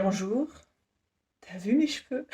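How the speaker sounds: soft, offhand boast about her hair; mock job-interview brag; cheeky quip. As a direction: toss it out casually, half joking. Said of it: "je vais dire".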